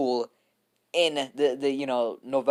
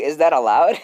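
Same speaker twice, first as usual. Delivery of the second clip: loud repeat; 4 semitones higher, 10 dB louder than usual. Retold or imitated. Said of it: "He's like".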